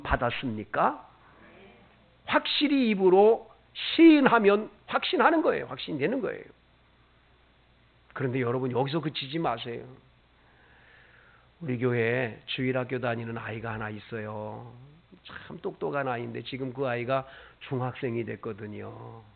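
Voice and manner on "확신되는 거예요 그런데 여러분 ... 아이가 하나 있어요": normal talking voice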